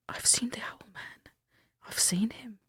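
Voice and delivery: unvoiced spooky whisper; eerie whisper. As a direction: breathe it like a ghost story. Spooky quote of vacationer.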